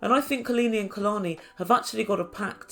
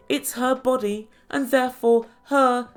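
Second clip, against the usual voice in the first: slow, measured and emphatic; very deliberate; 2 semitones higher than usual. Retold or imitated. Imitating Kalini defending a decision, very deliberate. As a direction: spell it out one phrase at a time. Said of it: "said very deliberately".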